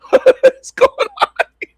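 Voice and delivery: high, laughing what is going on here; cracking up while watching the clip; laughing squeal. Real, not acted. Laughing at the video.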